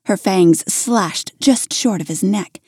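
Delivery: ordinary delivery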